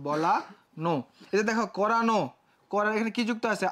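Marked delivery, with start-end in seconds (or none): 0.1-0.9 বলা, a pause, then নো with a closed o; split pronunciation. Shown as a demonstration. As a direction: break বলানো before the final no. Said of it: "বলা নো হয়ে গেছে".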